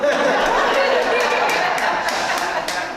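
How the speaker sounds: high loud burst of laughter. Real, not acted. Laughs at young crew.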